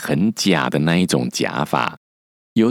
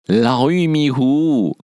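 high, slow teasing chant; sing-song taunt like naughty kids; 8 semitones higher, slower than usual. Retold or imitated.

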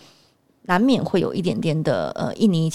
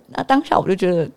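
ordinary talk, nothing marked

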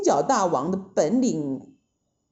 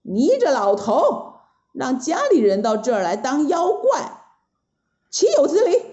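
angry scolding; 4 semitones higher than usual. Acted. Sun Wukong scolding the old god, angry. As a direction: tell the old man off hotly. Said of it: "就骂了".

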